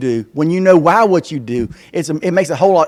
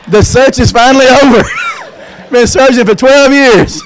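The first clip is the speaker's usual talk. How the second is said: higher, louder mock-triumphant exclaim; 11 semitones higher, 6 dB louder than usual. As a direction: celebrate like a twelve-year hunt just ended. Mock relief, found cousin.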